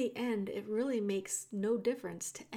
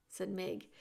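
plain reading voice

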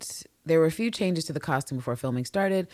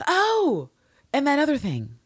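mock discovery; 11 semitones higher, 3 dB louder than usual. Imagined rewatch discovery.

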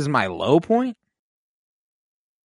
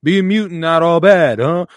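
higher, louder playful quip; teasing hero; 5 semitones higher, 5 dB louder than usual. Retold or imitated. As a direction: rub it in with a grin. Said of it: "and he's like".

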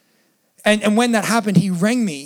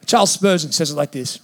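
ordinary delivery

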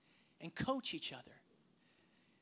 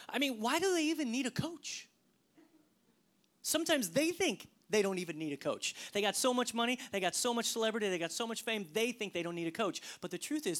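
normal talking voice

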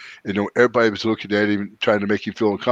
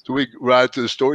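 usual speaking voice

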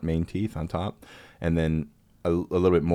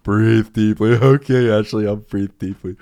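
loud, high, goofy drugged voice; giddily obeying, hammed-up and woozy; imitated laughing-gas high; 5 semitones higher, 11 dB louder, slower than usual. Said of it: "in my stupid drugged up head".

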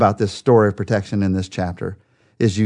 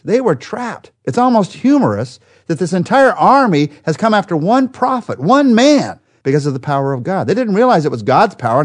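rising preaching; 6 semitones higher than usual. Acted. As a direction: build the tension of being trapped. Preacher builds on being trapped.

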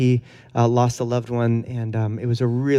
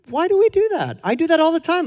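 incredulous; faster than usual. Real, not acted. Self-questioning about apologizing while crying.

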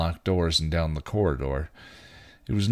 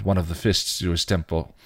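ordinary delivery

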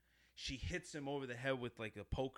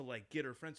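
ordinary talk, nothing marked

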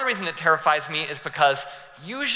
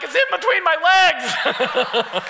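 high, loud, slow yell of panic broken by laughter; half-laughing as you scream it out; laughing panicked yell; 10 semitones higher, 6 dB louder, much slower than usual. Retold or imitated. Retelling the rocket in the car, a laughing panicked yell. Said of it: "and I'm like".

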